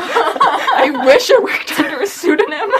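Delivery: loud, high and slow through laughter; real laughter carrying the words; laughing retort. Real, not acted. Teased about shilling a pseudonym, laughing loudly.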